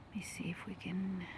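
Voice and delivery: soft whisper to the camera; hush, don't disturb the lake; quiet filming whisper. Real, not acted. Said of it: "whispering while filming".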